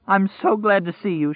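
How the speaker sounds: high, tearful and glad; smiling and crying. Acted. Woman greets the three strangers, high and tearful with joy. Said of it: "smiling and crying at the same time".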